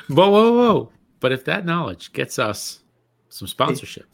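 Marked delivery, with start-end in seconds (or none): 0.1-0.9 excited. Real, not acted. Reacting to useless knowledge boast.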